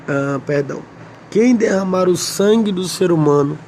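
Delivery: ordinary talk, nothing marked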